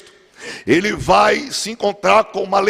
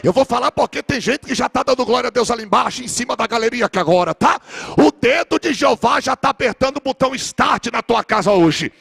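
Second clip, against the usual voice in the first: ordinary talk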